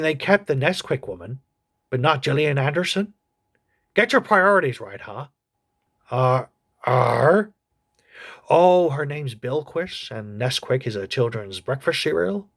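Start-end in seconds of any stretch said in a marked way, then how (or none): 6.1-7.4 creature grunt. Acted. Creature corrects the host.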